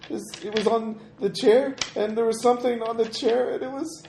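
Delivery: put-on frightened child voice, rising; a lost little girl, growing panicked; scared little girl. GM plays Emily, scared girl voice.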